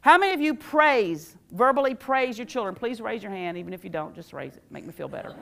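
normal talking voice

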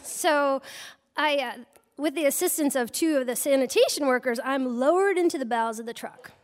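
ordinary talk

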